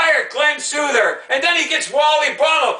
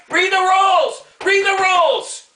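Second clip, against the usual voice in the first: pissed-off yelling; 7 semitones higher than usual. Real, not acted. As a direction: bark the order at him, fed up. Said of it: "Okay, I'm pissed off".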